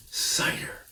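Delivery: breathy whisper. A narrator's reading. Badger whispers about the cider below the farmhouse. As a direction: breathe the word in awe. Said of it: "said Badger".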